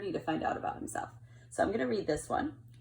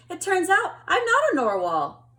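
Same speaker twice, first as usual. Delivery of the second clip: higher and louder little-narwhal voice; nervous announcement; 10 semitones higher, 10 dB louder than usual. Acted. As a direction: blurt out the big news. Kelp confessing to friends.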